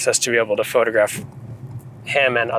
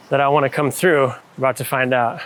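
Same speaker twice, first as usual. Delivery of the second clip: usual speaking voice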